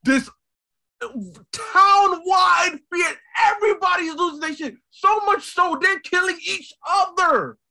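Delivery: loud, high, real outburst; impassioned shout. Real, not acted. Host driving home the town-wide fear, shouting. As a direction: hammer it out, stretching the first words.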